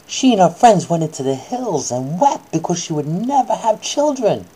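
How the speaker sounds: ordinary delivery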